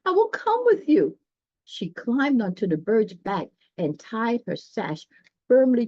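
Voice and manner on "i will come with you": high, loud joyful promise; eager cry. Acted. Thumbelina eagerly answering the swallow's plea. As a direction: call it out gladly. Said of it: "cried Thumbelina".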